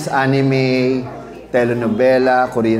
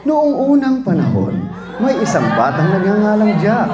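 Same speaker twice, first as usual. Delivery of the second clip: storybook narration; 5 semitones higher than usual. Shown as a demonstration. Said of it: "mga fairy tale".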